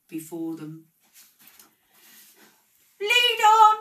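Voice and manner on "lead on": shrill high screech, much louder. A narrator's reading. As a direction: screech the command with all your force. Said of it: "screeched Scrooge".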